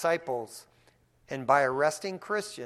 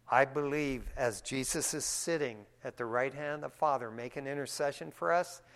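usual speaking voice

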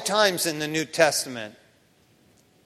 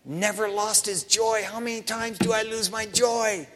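ordinary talk, nothing marked

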